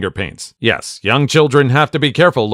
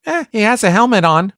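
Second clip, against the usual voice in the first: put-on little girl; 10 semitones higher than usual. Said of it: "She replied".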